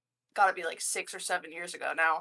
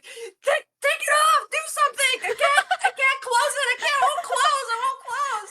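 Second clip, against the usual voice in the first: high frantic pleading; panicked pleading; 4 dB louder than usual. Retold or imitated. Begging coworkers to close the porn ad, high frantic pleading. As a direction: beg someone to shut it off, frantic.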